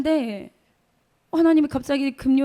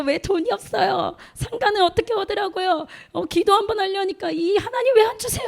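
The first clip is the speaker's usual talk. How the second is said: whiny complaint; 8 semitones higher than usual. Retold or imitated. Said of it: "울고불고".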